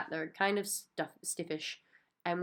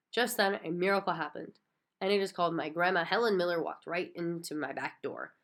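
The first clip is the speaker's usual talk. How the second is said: ordinary delivery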